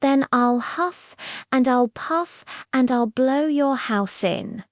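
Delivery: usual speaking voice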